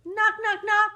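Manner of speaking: ordinary delivery, nothing marked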